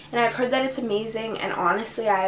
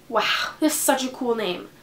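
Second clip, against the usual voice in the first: ordinary talk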